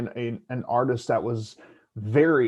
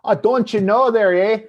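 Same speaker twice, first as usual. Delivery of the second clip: higher, louder Upper Midwest accent with a big eh; northern Midwest accent; 9 semitones higher, 8 dB louder than usual. Shown as a demonstration. South Dakota man mocking his northern accent, higher and louder. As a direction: ham up the northern "don't you know". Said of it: "then there's".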